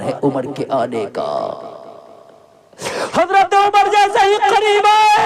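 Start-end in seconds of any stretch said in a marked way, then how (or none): 3.2-5.3 high, loud sermon cry of equality; fiery preaching. Acted. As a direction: proclaim it to the whole crowd at full force. Preaching at full force.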